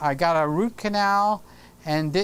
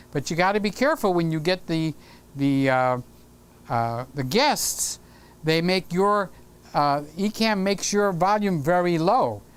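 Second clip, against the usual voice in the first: ordinary talk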